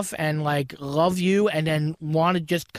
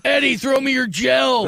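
slow, loud, higher construction worker shout; hollering like a guy on a building site; 5 semitones higher, 4 dB louder, slower than usual. Acted. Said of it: "construction worker voices".

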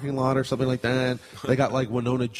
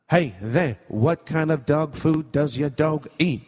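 [name]. Acted. Rapper working in a pet food store, morphing impression. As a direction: start as a laid-back rapper, turn into an old-time broadcaster. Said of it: "my impression of if [name]".

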